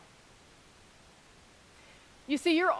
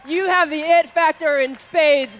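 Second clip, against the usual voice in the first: loud, raised voice to a big crowd; rousing shout-out; 6 semitones higher, 9 dB louder, slower than usual. Real, not acted. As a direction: belt out praise from the stage. Shouted shout-outs from stage.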